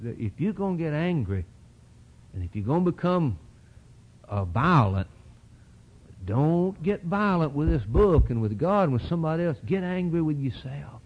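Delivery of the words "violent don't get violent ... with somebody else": ordinary delivery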